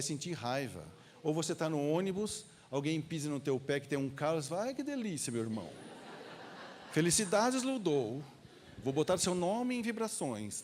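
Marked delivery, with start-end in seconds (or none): 4.6-10.6 mock delight. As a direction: gush sarcastically as if blessing someone who hurt you. Feigned delight when foot stepped on.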